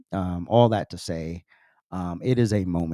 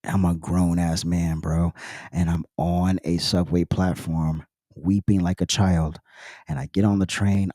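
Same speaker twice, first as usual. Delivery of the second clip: usual speaking voice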